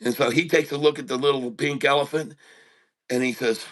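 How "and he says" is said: normal talking voice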